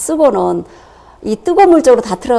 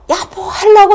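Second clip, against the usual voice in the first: irritated call; 9 semitones higher, 5 dB louder than usual. Retold or imitated. Starts to scold husband again.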